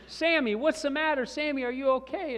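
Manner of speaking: high, loud, urgent questioning; worried dad. Retold or imitated. Dad bursts into the room to screaming son, alarmed.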